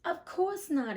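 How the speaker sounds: Dorothy answering the witch; girl's reply. Acted. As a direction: answer like a startled child. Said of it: "answered Dorothy".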